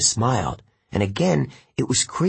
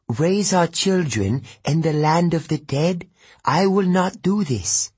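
put-on higher, firm Italian-accented mother; 8 semitones higher than usual. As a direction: refuse him in a strong Italian accent. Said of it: "She spoke with an Italian accent".

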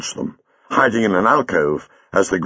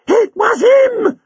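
high, slow, gasping terror; a woman gasping at a dreaded face; terrified gasp; 5 dB louder, slower than usual. Acted. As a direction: gasp it out in horror. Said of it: "she gasped".

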